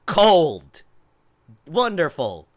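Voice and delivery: loud, sarcastic bark of the word; sarcastic cold complaint. Real, not acted. Streamer back home sick, sarcastically announcing cold. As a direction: spit out the word cold in mock despair.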